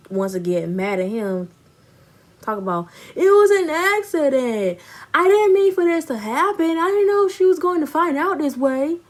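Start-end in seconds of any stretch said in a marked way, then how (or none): 3.2-9.0 high, loud whining excuse, mocking him; mock whiny excuse. Mocking her cheating ex's excuses in a high whiny voice. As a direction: make his excuses sound pathetic and high-pitched.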